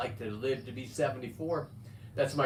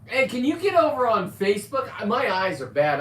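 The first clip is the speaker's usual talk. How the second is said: calling out; 9 semitones higher, 8 dB louder than usual. Real, not acted. Calling to viewers about comments.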